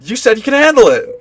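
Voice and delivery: defensive retort. Acted. Roommate snaps back defensively.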